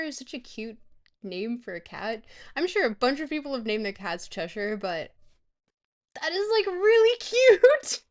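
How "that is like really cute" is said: high, loud squeal with a laugh; gushing squeal. Real, not acted. Streamer gushing over Cheshire as a cat name, delighted squeal.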